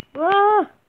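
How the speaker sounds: high, loud startled "Ah!"; sharp surprised shout. Real, not acted. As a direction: yelp at the screen. Reacting to game. (ξαφνιασμένη φωνή)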